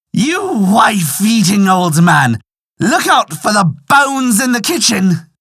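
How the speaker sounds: high, drawn-out jeering badger cry. A narrator's reading. Badger reveals himself to the old farmer, crying out in derision. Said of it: "cried out".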